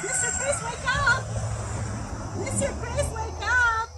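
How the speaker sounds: high, loud call to wake him. Pretend. Host to sleeping Mister Chris, loud high calling. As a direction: shout him awake, playfully.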